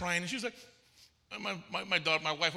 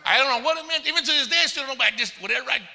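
loud and higher, amused exasperation; worked-up retelling; 6 semitones higher, 6 dB louder, faster than usual. Retold or imitated. Minister telling congregation how he gave in, loudly baffled. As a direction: throw it out loudly, comically baffled.

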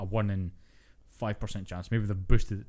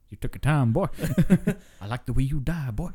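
louder, laughing mock southern cowboy; cowboy gunslinger; 4 semitones higher, 5 dB louder than usual. Friend riffing as a rocking-chair cowboy, mock southern gloat. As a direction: ham it up as a grinning gunslinger.

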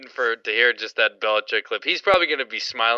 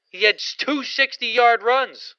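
incredulous; 10 semitones higher, slower than usual. Real, not acted. Incredulous rant about poor defense.

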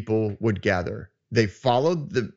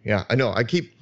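ordinary talk, nothing marked

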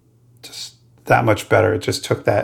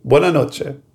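put-on Italian accent; 4 semitones higher than usual. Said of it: "wrong language".

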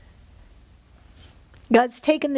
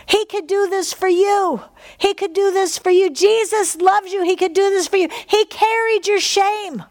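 higher, pleading preacher's climax; fervent preaching; 7 semitones higher than usual. Acted. Preacher urging listeners to believe, higher fervent plea. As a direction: press the promise home to them.

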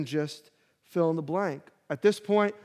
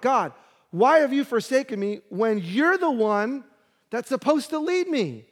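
higher and louder pleading cry; anguished protest; 6 semitones higher, 5 dB louder than usual. Acted. David the king crying out at God, higher and louder. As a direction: throw the question back at God.

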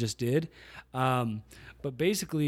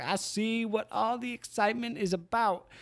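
higher, amused imitation of Magic; Magic impression; 9 semitones higher than usual. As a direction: mimic Magic mid-laugh, lightly. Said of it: "he started laughing, and he was like".

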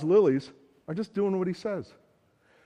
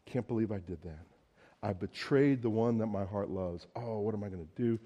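low, soft, regretful murmur; remorseful Peter; 8 semitones lower, 9 dB quieter than usual. Retold or imitated. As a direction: sink into Peter's regret, much softer. Peter grieving after betrayal.

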